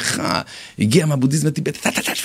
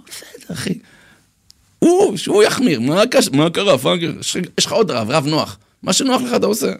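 much higher imitated rabbi voice; mock a laid-back rabbi, pitched way up; lenient rabbi; 7 semitones higher than usual. Easygoing rabbi brushing off a strict question, high mock voice.

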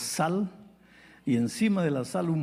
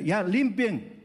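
higher, insistent repeat of the order; firmer order; 8 semitones higher, much slower than usual. Retold or imitated. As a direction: press the maids harder. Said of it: "El gerente las mira". (orden más insistente y aguda)

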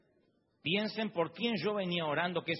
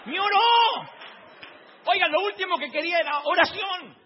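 loud, high comic outrage; hammed-up furious protest; exasperated rant; 9 semitones higher, 10 dB louder than usual. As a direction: shout the complaint, punching each word. Comic rant about unwanted prayer.

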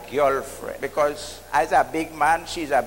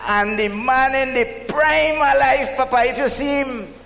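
building laughter; 7 semitones higher than usual. Real, not acted. Punchline builds, audience laughing.

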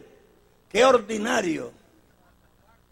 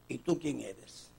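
soft questioning; 8 semitones lower, 15 dB quieter, slower than usual. Acted. John questioned, quiet take.

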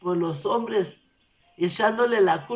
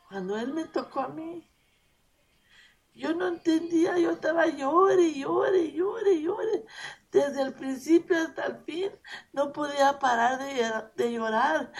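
tearful; 7 semitones higher than usual. Real, not acted. Retells her conversion, voice breaking.

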